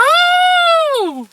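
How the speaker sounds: very high, sustained squeal of "oh"; high drawn-out "ooh". Retold or imitated. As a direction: hold a long falsetto "ohhh". Imitates teasing reaction after strange pause.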